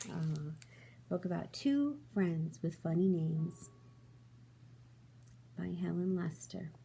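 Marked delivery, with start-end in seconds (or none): none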